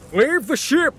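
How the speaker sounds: high, loud urgent shout; captain shouting. Acted. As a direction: bark the order to abandon ship. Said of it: "the captain shouted to everyone".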